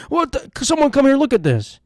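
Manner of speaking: high, panicked call for someone; hammed-up alarm, voice leaping way up, then dropping; mock screaming. Passenger in the plane bathroom calling for help, mock panicked scream. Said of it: "just start screaming".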